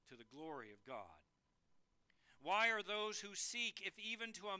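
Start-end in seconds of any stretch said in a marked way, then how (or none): 0.1-1.2 low, very soft close; hushed reverence. Real, not acted. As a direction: let it fall to a reverent hush. Reverent close after a long pause.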